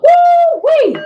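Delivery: high, excited squeal of delight; sudden high woo-wee of joy; excited whoop. Real, not acted. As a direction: whoop it out with glee. Excited reaction to a time in the chat.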